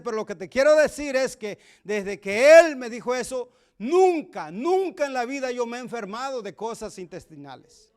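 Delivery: más fuerte, con gozo y fervor de predicador; predicación fervorosa. Acted. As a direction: proclamar el testimonio a toda voz. Testimony rises into loud preaching. (predicador da su testimonio a la congregación, fuerte y gozoso)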